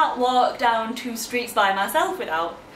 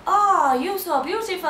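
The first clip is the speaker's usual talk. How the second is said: higher, louder gushing compliment; gushing stranger; 8 semitones higher, 3 dB louder than usual. Retold or imitated. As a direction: gush over her like a fan. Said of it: "touch my face and my hand and go".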